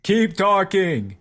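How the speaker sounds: drawn-out heckler's call; crowd heckler. Acted. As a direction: draw it out as a jeer to the speaker.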